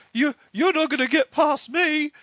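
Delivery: put-on high, loud teenage bravado; mock tough teen challenge; cocky teenager. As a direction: puff up like a brash kid. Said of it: "He's like, this teenager".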